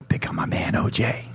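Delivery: low unvoiced whisper; whispered call. Preacher voicing God whispering to a lost man, low whisper. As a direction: breathe the name like a secret.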